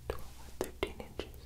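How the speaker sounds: normal talking voice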